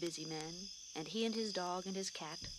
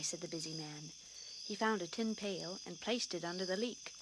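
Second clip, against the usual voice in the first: ordinary delivery, nothing marked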